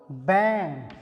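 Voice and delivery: "बैंड" with a long open a as in band; drawn-out "बैंड"; model word. Shown as a demonstration. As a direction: say "बैंड" with a wide long vowel. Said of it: "आपको example".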